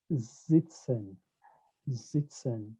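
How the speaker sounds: "sitzen" starting with a buzzed Z sound; voiced Z at the start of "sitzen". Shown as a demonstration. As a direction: say "sitzen" as zit-sen, German style. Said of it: "I pronounce it as a Z".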